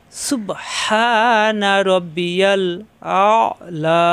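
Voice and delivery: very slow, drawn-out Arabic recitation. Shown as a demonstration. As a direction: stretch each sound of সুবহানা, pressed হা. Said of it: "হা কণ্ঠনালীতে চাপ দিয়ে". (ধীরে টেনে তেলাওয়াত)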